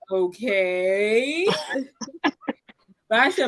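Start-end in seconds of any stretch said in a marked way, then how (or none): 0.1-1.5 long drawn-out okay, rising at the end; stretched, questioning mock indifference; imagined unimpressed mom. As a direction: imitate a mom humouring you, very slowly. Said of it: "my mom was just gonna be like".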